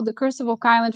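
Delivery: ordinary talk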